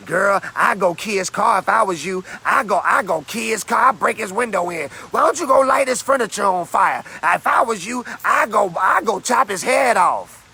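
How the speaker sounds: high, loud revenge urging; egging friend. Retold or imitated. Girlfriend urging revenge on her ex, loud.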